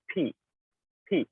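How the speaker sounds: পি with lips shut, then a sudden burst of air; পি released with a sharp puff; পি the English way, with a crisp aspirated p. Shown as a demonstration. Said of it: "দুইটা ঠোঁট একসাথে বন্ধ রাখতে হবে".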